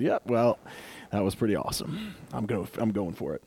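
soft, low satisfied voice; hushed giving in. Acted. Preacher voicing someone giving in, soft and low. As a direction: murmur it like you caved.

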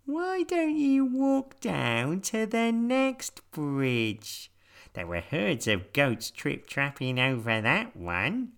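high, slow bouncy rabbit. A narrator's reading. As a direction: speak like a little rabbit. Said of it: "said the rabbit".

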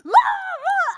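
sudden high, loud pained cry; son's scream after the bike crash, high and piercing; imitated scream. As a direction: mimic a kid screaming bloody murder. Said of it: "I don't wanna totally imitate him".

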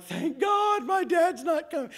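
very high, slow shout of relief; hammed-up gasp of relief; relieved shout. Boys relieved their dad is not coming, high and loud.

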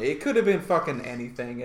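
usual speaking voice

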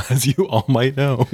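breaking into laughter mid-phrase; giggling through the repeat; laughing. Shown as a demonstration. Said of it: "repeated, higher, laughing".

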